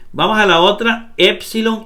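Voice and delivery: normal talking voice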